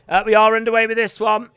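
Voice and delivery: loud, quick fight commentator call; play-by-play. Real, not acted. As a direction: announce the bout is underway. Live fight commentary begins.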